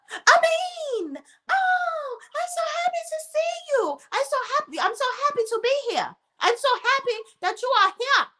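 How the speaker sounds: mock her fake joy at seeing him; mock gushing excitement. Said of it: "She acting like she's so excited".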